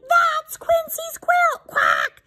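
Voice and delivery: very high squawked alarm; quacking duck. Acted. Noisy duck rallies animals to catch the dog, high quacking cry. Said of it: "quacks Dottie".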